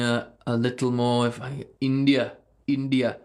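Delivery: half-hearted Indian accent impression; bad Indian accent. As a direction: lightly put on an Indian accent through the nose. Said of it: "it's a really bad impression".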